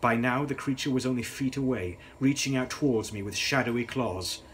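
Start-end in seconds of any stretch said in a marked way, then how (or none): none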